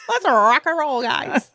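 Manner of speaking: mock pep, held back in volume.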